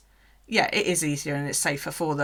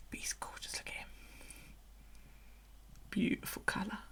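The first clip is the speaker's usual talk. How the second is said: whisper; 2 semitones higher, 14 dB quieter than usual. Real, not acted. Admiring snake softly.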